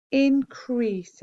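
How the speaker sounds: ordinary delivery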